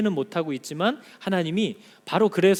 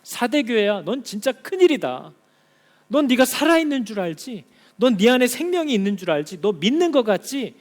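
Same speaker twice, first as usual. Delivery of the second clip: higher, louder urgent voice of God; God rebuking the church; 5 semitones higher, 5 dB louder than usual. Acted. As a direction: sound the alarm as God.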